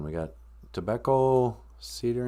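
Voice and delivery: ordinary talk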